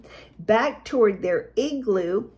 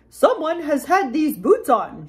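higher, put-out mama bear; huffing mama bear; 4 semitones higher, 3 dB louder than usual. A narrator's reading. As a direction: huff it as an offended mama bear. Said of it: "she huffed".

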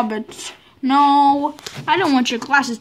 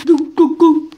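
cheering go; 3 semitones higher, 7 dB louder, slower than usual. Real, not acted. Cheering someone on.

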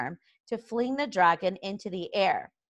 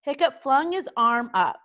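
plain reading voice

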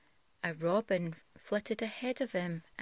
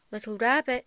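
put-on louder, higher call for the lost child; 5 semitones higher, 9 dB louder, faster than usual. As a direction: call out into the wind. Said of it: "he called".

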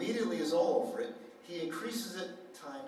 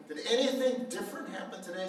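higher, faster disbelieving question; mother doubting her son's good mood, incredulous; incredulous probing; 6 semitones higher, 5 dB louder, faster than usual. Retold or imitated. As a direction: probe as if it can't be true.

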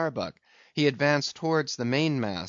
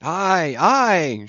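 loud, higher eager assent; shout; 7 semitones higher, 8 dB louder than usual. A narrator's reading. Harpooners answer the captain's call, shouting. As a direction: shout back agreement to the captain. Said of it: "shouted the".

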